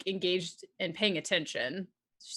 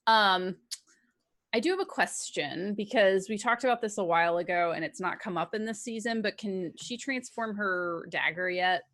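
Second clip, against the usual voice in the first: ordinary talk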